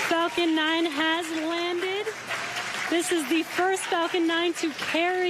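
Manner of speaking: thrilled, slow and high; beaming over the landing; excited. Real, not acted. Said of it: "so very exciting for us".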